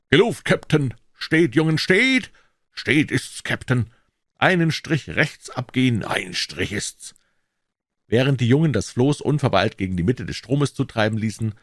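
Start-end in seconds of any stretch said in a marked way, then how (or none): 0.1-7.1 barked drill commands. A narrator's reading. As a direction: shout the reply to the captain. Crew calls back pirate orders. (laut gebellte Seemannsrufe)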